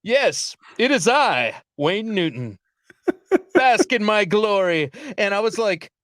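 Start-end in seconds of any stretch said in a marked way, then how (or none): 0.0-4.9 self-important star, lightly voiced. Vegas icon greeting a stranger at the airport, mock-grand. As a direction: grandly announce yourself, savouring the last words slowly. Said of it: "it was like he was saying".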